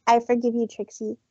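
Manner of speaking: ordinary delivery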